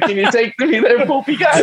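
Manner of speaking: high, squeaky mock condescension. Stranger belittling the driver, high squeaky mockery. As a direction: talk down to him like a toddler.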